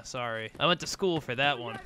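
usual speaking voice

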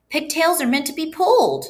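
high-pitched, emphatic declaration; confident conclusion. A narrator's reading. Boy justifying himself to teacher, emphatic conclusion. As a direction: declare it high, lifting the key words. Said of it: "Paul concluded".

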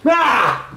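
loud sudden "ah" to scare; startling boo. Real, not acted. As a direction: jump out and yell to scare. Did I scare you?.

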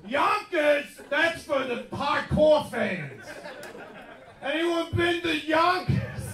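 high, stretched-out crowd call; hollered place names. Acted. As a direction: shout it out slowly to the hardcore fans. More shouted place names.

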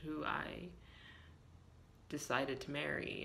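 ordinary talk

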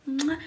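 quick "mwah" kiss; kiss noise. Real, not acted. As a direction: blow viewers a quick audible kiss. Blown kiss to viewers.

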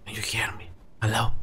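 very soft whispered check; barely audible whisper. Real, not acted. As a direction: whisper to see if you are heard. Testing the mic without screaming.

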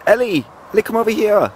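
high calling to the dog. Real, not acted. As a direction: call her over across the garden. Calling dog across garden.

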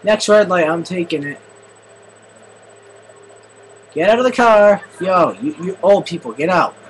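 loud high barking orders at drivers; bossy yell. Acted. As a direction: order them out of the car loudly. Carjacking, yelling at drivers.

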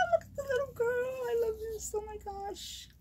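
very high gushing squeal; thrilled, tiny high voice; delighted squeal. Real, not acted. Squealing over gift pieces.

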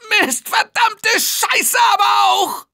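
raging shout; furious cursing. Acted. Cursing a teammate's death.

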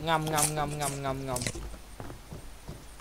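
slow drawn-out nom nom chewing sounds; munching noises. Pretend. Gamer miming his character eating, slow munching sounds. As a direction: chew it out slowly like a cartoon eater.

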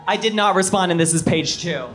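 ordinary talk, nothing marked

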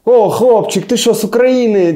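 high, loud excited greeting; excited bar stranger. Retold or imitated. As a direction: call out like a cheerful drunk stranger. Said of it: "в барах всё время говорят".

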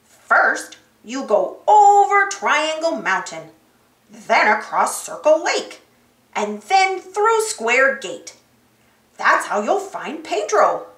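measured cartoon guide voice; Map, helpful guide. Talking map gives the explorers directions, emphatically. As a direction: lay out the route in character.

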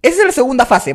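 usual speaking voice

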